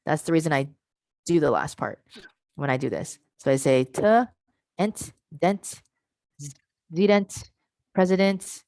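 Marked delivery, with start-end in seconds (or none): 3.8-8.6 "president" built from the end piece by piece, t, ent, dent, zedent, with pauses; back-chained. Shown as a demonstration. Teacher demonstrating back chaining, "president" built up from the final t. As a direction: grow "president" backwards from its last sound, pausing after each piece. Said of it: "look at how I back chained it".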